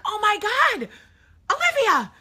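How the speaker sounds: high, loud cry of sudden realization; panicked shout. Retold or imitated. Mom remembers she forgot to pick up her daughter, panicked shout. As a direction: yell out in alarm on remembering.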